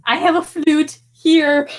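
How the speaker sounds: high-pitched mock offer; put-on eager voice. Player offering a flute, high put-on voice.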